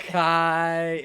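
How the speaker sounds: slurred, drawn-out tipsy word. Real, not acted. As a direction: slur it like you're tipsy. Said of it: "because I'm drunk".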